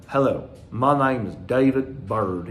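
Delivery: put-on slow, drawn-out South Carolina accent. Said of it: "with his South Carolina accent".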